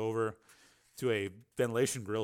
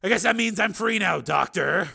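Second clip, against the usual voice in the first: loud, raised, cutting taunt; cruel; 8 semitones higher, 8 dB louder than usual. A narrator's reading. Steele holding Susan by the shoulders, cruel. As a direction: taunt her loudly. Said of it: "he said cruelly".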